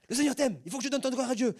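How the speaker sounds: loud, high imitation of a scolding prophet; mock-bellow it like an angry reprimand; telling someone off. Imitating his brother giving a prophecy to newcomers, scolding shout. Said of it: "Dieu est en train de l'engueuler".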